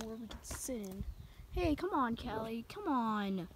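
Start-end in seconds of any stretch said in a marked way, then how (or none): none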